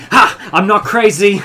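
loud, high exclamation breaking into laughter; triumphant outburst. Acted. Player's character jumping back at the crew, triumphant shout. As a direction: burst out with sudden vindication.

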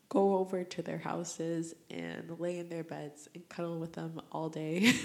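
ordinary talk, nothing marked

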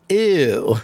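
short ew through a laugh. Shown as a demonstration. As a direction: laugh out a quick grossed-out ew. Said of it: "It's the E W W W W W".

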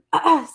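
clearing a stuffed-up throat; quick rough throat clear. Real, not acted. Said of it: "I sound like I'm stuffed up".

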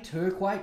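"Turquoise" said as TUR-kway, with an er vowel; alternate pronunciation. Shown as a demonstration. Tries alternate pronunciations of town name.

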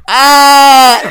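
loud high scream; mock scream. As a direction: scream out suddenly. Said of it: "we hear".

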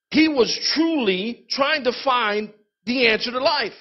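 ordinary delivery

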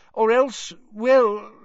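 high, hesitant, flustered; embarrassed. A narrator's reading. Fudge flustered before Dumbledore, embarrassed. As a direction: stall awkwardly. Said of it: "Fudge sounded embarrassed".

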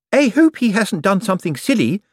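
anxious, high-pitched secretary; Miss Drapes, tearful. Acted. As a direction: fret aloud, pitch held high. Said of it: "A tearful Miss Drapes".

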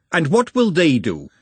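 snapped. A narrator's reading. Glenda cuts down Juliet's suggestion, snapping. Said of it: "Glenda snapped".

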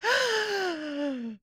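dramatic gasp. Acted. Reacts to murder clue.

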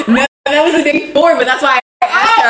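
high laughing voice. Real, not acted. Telling friend about the cat, high laughter.

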